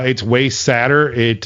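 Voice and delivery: ordinary talk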